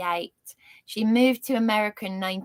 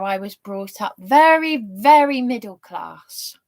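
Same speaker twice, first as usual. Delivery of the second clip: usual speaking voice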